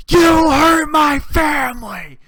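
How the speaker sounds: high, drawn-out furious shout; yell. Retold or imitated. Driver yelling at a passing city bus, high furious shout. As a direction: scream it out at them, long and high. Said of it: "I flip them off and I yell".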